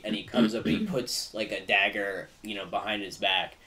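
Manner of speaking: usual speaking voice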